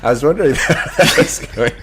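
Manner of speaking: talking through a laugh; amused, giggling through the words. Real, not acted. Co-host laughing at the Irish accent drill. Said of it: "laughing at the demo".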